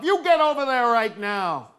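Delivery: loud, imperious parental command; mother's stern order. Retold or imitated. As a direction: lay down the law like a fed-up mother. Acted mother giving an order.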